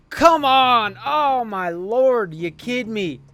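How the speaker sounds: loud, high groan of frustration; real anger at a lost sim game; frustrated outburst. Real, not acted. Gamer loses by a field goal, frustrated yelling. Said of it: "I'm frustrated, man. I'm angry".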